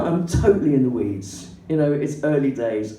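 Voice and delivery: ordinary talk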